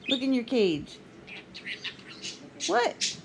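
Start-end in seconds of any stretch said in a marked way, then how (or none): 2.7-3.2 high chirpy bird voice; squeaky talking-bird question; parakeet chatter. Real, not acted. Pet parakeet talking back.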